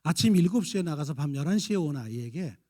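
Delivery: ordinary delivery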